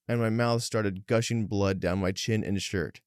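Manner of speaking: ordinary delivery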